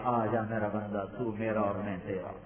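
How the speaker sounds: hushed, low, tender call; a loving embrace in the voice; tender welcome. Acted. Preacher voicing God welcoming His servant, hushed and tender.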